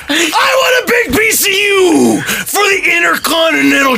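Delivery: high, strained wrestling promo shout; Macho Man. Acted. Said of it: "in your wrestling voice".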